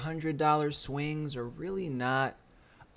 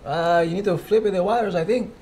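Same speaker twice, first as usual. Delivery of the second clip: loud, higher call across the room; 5 semitones higher, 12 dB louder than usual. Real, not acted. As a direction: shout the instructions over. Calling instructions across room.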